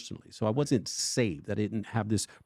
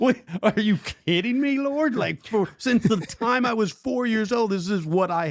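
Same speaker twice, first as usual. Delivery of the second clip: high, loud disbelieving protest; incredulous protest; 11 semitones higher, 3 dB louder than usual. Retold or imitated. Protesting to God about his calling, high and incredulous.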